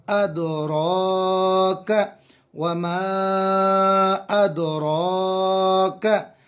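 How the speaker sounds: अद रा का chanted slowly, each syllable drawn; slow recitation. Shown as a demonstration. Teacher builds the phrase for students, slow chant.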